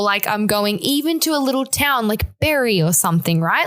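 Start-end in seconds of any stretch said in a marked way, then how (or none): none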